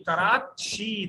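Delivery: कराची stretched very slowly, ची clear; slow model reading. Shown as a demonstration. Teacher modelling कराची slowly for the class. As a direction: draw out कराची syllable by syllable. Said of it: "जब कराची कहते हैं".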